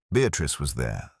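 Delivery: usual speaking voice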